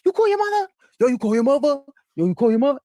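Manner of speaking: high, loud, repeated nagging; friends nagging. Retold or imitated. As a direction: pester her like all her friends. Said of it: "everybody would".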